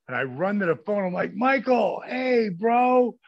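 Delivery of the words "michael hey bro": hammed-up excited hollering; excited shout. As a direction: yell it down the phone, thrilled. Excited yelling into phone at Michael.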